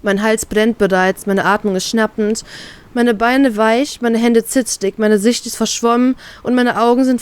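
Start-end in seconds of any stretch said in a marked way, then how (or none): none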